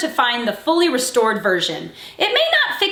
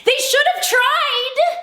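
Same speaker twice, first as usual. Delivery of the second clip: emphatic high exclamation; 11 semitones higher than usual. Real, not acted. Emphatic punchline.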